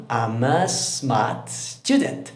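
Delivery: slow, careful model reading of "I'm a smart student"; teacher modelling "I'm a smart student" very slowly. Shown as a demonstration. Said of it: "tú tratas de imitar".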